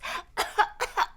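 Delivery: a small dry cough from the dust; girl coughing in tractor dust; dusty cough. A narrator's reading. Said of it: "Mona coughed".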